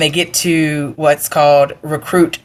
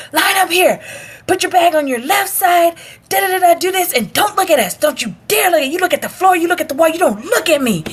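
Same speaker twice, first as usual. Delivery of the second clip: high-pitched mock drill orders; imitated yelling; 12 semitones higher than usual. Instructors lining up new recruits, imitated yelling. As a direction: rattle off commands like a strict instructor. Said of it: "when they're yelling at you".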